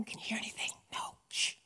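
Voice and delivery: hushed, whispered question and reply. Retold or imitated. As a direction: whisper so nothing in the forest hears. Family waiting by the dark forest.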